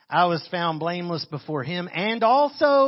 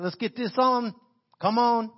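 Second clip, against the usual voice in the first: pestering; 5 semitones higher than usual. Retold or imitated. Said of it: "They're pestering him".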